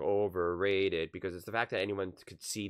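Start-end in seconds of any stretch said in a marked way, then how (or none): 0.0-1.1 slow, drawn-out mock scorn; sarcastic. Analyst mocking critics, slow and sarcastic. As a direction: sneer the word Overrated slowly. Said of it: "he was saying it sarcastically".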